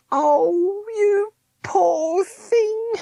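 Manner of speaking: very high, slow, sobbing woman; crying pity. A narrator's reading. Meg weeps for Smith, very high and slow. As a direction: wail it out, near tears.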